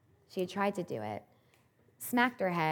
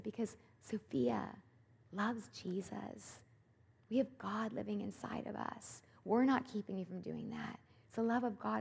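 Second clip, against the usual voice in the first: gentle reassurance; 3 semitones higher, 7 dB quieter than usual. Retold or imitated. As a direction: soothe her quietly, one word at a time. Comforting frightened child.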